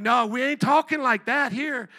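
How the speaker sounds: slow, loud, firm correction; firm rebuke. Retold or imitated. Preacher correcting cussing kids, slow loud rebuke. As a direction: lay down the rule slowly. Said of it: "And I'm like".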